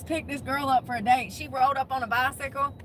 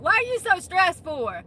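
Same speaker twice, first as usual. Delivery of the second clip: mocking nag; 7 semitones higher, 5 dB louder than usual. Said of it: "get in your face and be like".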